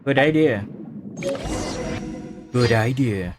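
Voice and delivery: usual speaking voice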